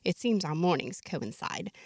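ordinary delivery

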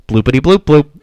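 high loud bubbly bloops. Pretend. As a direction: burble it like a machine. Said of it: "Ready? Here we go".

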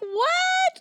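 very high incredulous squeal; mock shock. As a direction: act out a stunned high-pitched yelp. Retelling his shock at seeing Tad.

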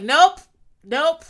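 loud, sharp rising nope; emphatic refusal. Retold or imitated. Dina cutting off Glenn's prayer, loud and emphatic. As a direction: shut him down fast.

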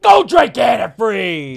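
loud, high, drawn-out mock yell; shouted insult. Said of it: "Just like that".

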